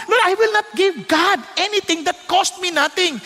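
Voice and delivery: high, fervent preaching declaration; fervent vow. Acted. Preacher voices David's vow to the Lord, high and fervent.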